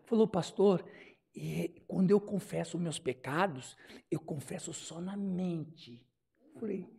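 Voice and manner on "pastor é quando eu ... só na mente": slow, low, soft meek voice; imitating humble churchwoman. Humble church sister confides in her pastor, mock meek. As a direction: confide it meekly, drawing out the words. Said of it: "tão, tão humildezinha".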